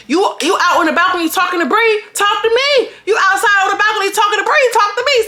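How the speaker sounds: high, fast mocking taunt; a high, fast jeering challenge; taunting Ivory. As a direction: needle her like a trash-talking rival. Voicing Ivory taunting Cash.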